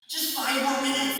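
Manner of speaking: mock kid whining to his siblings to keep playing; whiny kid stalling. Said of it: "every time my siblings would ask me".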